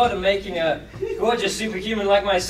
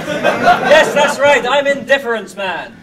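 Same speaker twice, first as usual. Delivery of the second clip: loud proud declaration; 4 semitones higher, 9 dB louder, slower than usual. Acted. Heroic answer to outburst.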